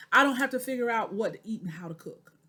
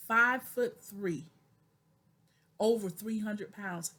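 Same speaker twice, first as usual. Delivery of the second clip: ordinary delivery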